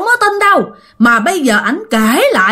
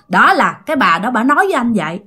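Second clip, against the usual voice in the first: plain reading voice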